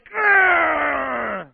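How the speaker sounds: loud, high mock snarl; angry growl. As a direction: growl like an enraged king. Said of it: "he's like".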